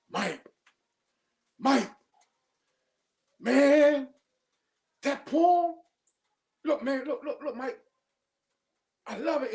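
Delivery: retold rave, high-pitched and loud on man and poem; quoting the coach's thrilled text; excited praise. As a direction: gush over the poem like the coach did.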